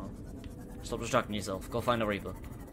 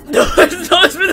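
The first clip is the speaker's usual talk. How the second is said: shrieking very high, building louder; hysterical panic; 12 dB louder than usual. Real, not acted. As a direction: scream through breathless laughter. Screaming as Reaper attacks.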